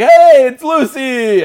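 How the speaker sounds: loud, slow, high delighted shout of recognition; excited. Retold or imitated. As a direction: call out her name thrilled. Excited spotting actor in movie.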